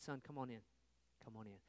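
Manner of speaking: soft, quiet coaxing; a father softly inviting his son in; father gently urging his son to join the party, soft; gentle father. Acted.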